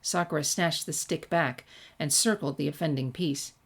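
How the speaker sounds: ordinary delivery, nothing marked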